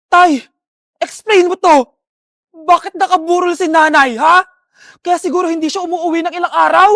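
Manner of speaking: loud, hurt demand; confronting father. Acted. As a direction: shout it at him, wanting answers. Daughter confronts father about mother's wake.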